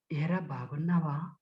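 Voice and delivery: soft, low, unhurried question; slow and gentle. Shown as a demonstration. Speech trainer demonstrates a gentle greeting, soft and slow. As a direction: ask it slowly and softly. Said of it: "నెమ్మదిగా".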